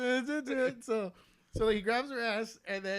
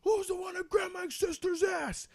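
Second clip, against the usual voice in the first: slow, higher, shouted challenge; mock angry brother; 10 semitones higher than usual. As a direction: bellow it slowly like a furious big brother. Angry brother confronting them.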